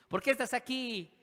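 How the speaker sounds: high-pitched, insistent questioner; prisoner interrogating. Acted. Inmates asking each other.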